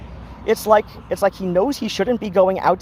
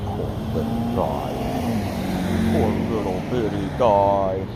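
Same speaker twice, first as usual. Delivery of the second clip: pet talk; 8 semitones lower, 3 dB quieter than usual. Real, not acted. Cooing to her cat.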